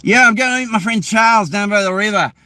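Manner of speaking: high-pitched Aussie accent, R's dropped; Australian accent. Shown as a demonstration. Showing how Aussies would say it, Australian accent. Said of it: "Aussies would be like".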